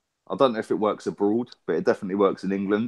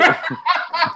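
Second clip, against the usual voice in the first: high laugh. Retold or imitated. Imitating the engineer's laugh.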